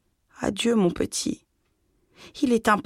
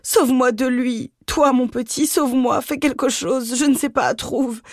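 cling to him and beg; distressed cry; 7 semitones higher than usual. A narrator's reading. Mother begging her son to save her, distressed cry. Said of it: "elle s'écria, l'âme en détresse".

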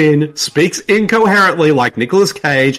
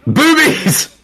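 laughing exclaim; 10 semitones higher than usual. Real, not acted. Laughs at nude scene.

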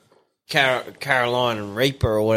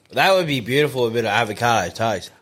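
usual speaking voice

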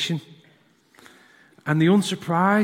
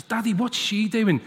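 lightly voiced curious little boy; a hint of a small child asking dad; curious boy, lightly done; 6 semitones higher than usual.

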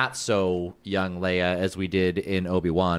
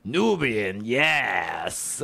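drawn-out, swooping movie quote; quoted movie line; much slower than usual. Retold or imitated. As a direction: draw out both words like a hammed-up film line. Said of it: "my favorite line from the prequel trilogy".

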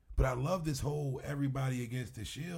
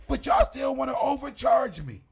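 fed-up shout; 11 semitones higher, 9 dB louder than usual. Real, not acted. Venting about overcharging wrestlers.

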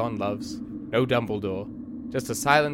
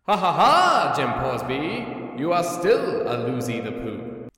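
mocking taunt; 3 semitones higher, 3 dB louder, slower than usual. Taunting the fallen bear, gloating and slow. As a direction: cackle down at the fallen enemy.

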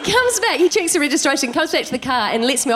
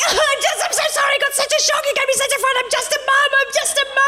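high, fast, wailing apology through fake tears; a hammed-up crying mum begging the cop; mock sobbing plea; 13 semitones higher, faster than usual. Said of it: "I just start crying".

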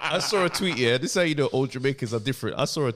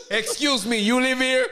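imitating Jamaican dad; 9 semitones higher, 3 dB louder, slower than usual. Repeating the Jamaican dad line.

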